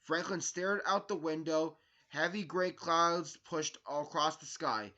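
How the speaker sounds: usual speaking voice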